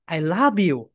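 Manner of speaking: low "I", a swelling push on "love", falling on "you"; stress on love. Shown as a demonstration. Teacher shows how British and Americans talk, stress on "love". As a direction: swoop up onto "love" and drop off. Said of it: "তখন তারা এইভাবে কথা বলবে".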